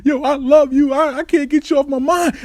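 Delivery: high-pitched mock tearful confession; imitated crying. Looking in the mirror like a lover, imitated crying. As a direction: plead in a retold weepy voice. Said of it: "I'm just crying and crying".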